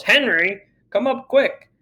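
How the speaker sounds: high, urgent call up the stairs; mother yelling. Acted. Mother calling her husband upstairs, high urgent yell. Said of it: "And she yells".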